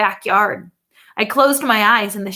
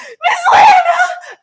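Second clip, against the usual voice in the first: joyful cry; 10 dB louder, slower than usual. A narrator's reading. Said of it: "I cried".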